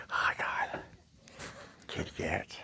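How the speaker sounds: whispered exclamation; whispering. Real, not acted. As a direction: breathe it without voice.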